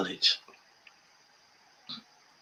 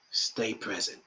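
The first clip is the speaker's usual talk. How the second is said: usual speaking voice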